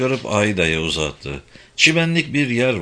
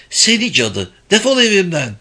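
higher, louder furious outburst; angry shouting; 8 semitones higher, 4 dB louder than usual. A narrator's reading. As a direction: throw her out, furious. Mother screams at Simone.

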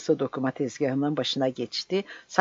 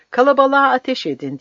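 much higher, louder frantic command; yelled order; 9 semitones higher, 9 dB louder than usual. Acted. Sergeant screaming at soldiers to shoot the crowd. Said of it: "diye haykırdı Rumelili çavuş da".

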